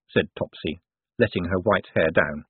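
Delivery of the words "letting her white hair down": ordinary delivery, nothing marked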